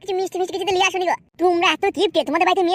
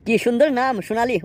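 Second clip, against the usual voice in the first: ordinary talk, nothing marked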